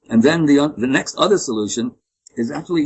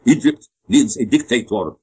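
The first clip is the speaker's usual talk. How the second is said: put-on a light Egyptian accent, quoting Zahi at dinner; Zahi's strong Egyptian accent. Retelling Zahi's dinner remark to his tour group, Egyptian accent. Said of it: "this strong accent".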